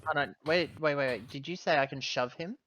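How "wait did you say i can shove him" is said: normal talking voice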